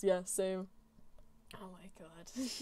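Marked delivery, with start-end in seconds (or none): 1.6-2.5 breathless whispered aside. Real, not acted. Streamer embarrassed at chat's comment, whispering. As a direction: murmur it under your breath.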